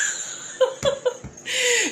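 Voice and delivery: squealing high laugh; high laughing fit. Real, not acted. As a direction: laugh helplessly. Laughing hard.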